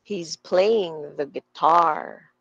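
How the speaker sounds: slow, with "playing" and "guitar" stressed, "the" light; stressed content words. Shown as a demonstration. Teacher modelling sentence stress, "playing" and "guitar" drawn out slowly. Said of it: "So, this should sound like".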